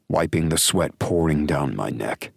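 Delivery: usual speaking voice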